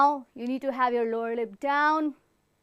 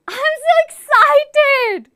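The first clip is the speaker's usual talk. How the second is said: much higher and louder, drawn out; excited; 13 semitones higher, 12 dB louder, slower than usual. Shown as a demonstration. Teacher showing the class excitement, squealing high. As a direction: burst out thrilled and slow. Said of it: "that is excited".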